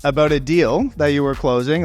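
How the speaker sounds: ordinary talk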